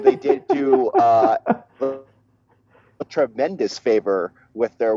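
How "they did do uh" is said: laughing through the words, pitched high. Real, not acted. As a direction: laugh while trying to continue. Laughing at apology to host.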